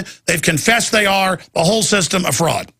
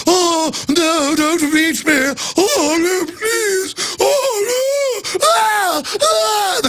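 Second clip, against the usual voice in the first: very high, whiny fake cries; hammed-up cowardly falsetto pleading; mock whimpering coward; much slower than usual. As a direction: whimper like a helpless man pleading. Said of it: "the men just fall down ... and go".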